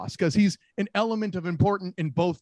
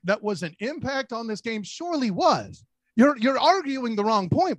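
usual speaking voice

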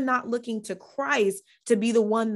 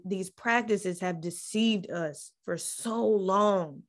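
usual speaking voice

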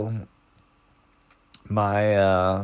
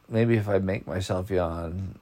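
normal talking voice

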